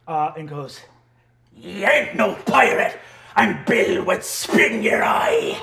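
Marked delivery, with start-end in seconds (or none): 1.6-5.6 slow, stretched-out crazed holler; hammed-up wild pirate voice; Chungle Down Bim. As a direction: yell it as a deranged old sea dog. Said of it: "Chungle Down Bim, uh, turns to you".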